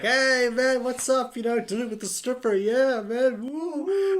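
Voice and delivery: high, hammed-up dude-bro greeting. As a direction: play the oblivious party bro, way up high. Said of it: "He's like".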